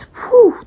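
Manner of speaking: high, sharp whew at a choking cloud; mock whew at strong scent. Mock whew at perfume cloud. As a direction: blow it out like the smell hits you. Mimicking a room full of perfume.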